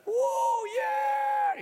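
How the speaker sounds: very high, loud whoop; triumphant shout. Acted. Boy lifts the huge pebble, whooping high and loud. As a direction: cheer like you just lifted a mountain.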